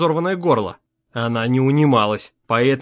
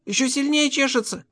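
put-on high child voice, complaining; маленькая дочь; 11 semitones higher, 3 dB quieter than usual. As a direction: whine to daddy in a little girl's high voice. Дочь жалуется папе на зуд. (жалобный высокий детский голосок)

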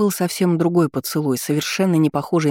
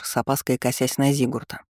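usual speaking voice

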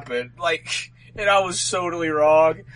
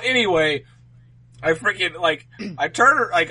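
normal talking voice

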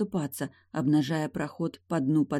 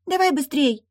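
high, loud urging; impatient water spirit; 12 semitones higher, 6 dB louder than usual. Acted. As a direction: hurry him in a high voice. Second creature hurries the boy.

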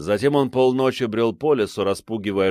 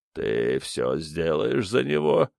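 low slow creature; 4 semitones lower, 4 dB quieter, slower than usual. A narrator's reading. Creature questions the mother.

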